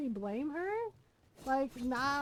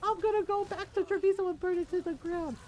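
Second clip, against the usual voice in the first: higher, louder, laughing quote; higher amused echo; laughing retell; 6 semitones higher, 3 dB louder than usual. Retold or imitated. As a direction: repeat it half laughing. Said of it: "he was like".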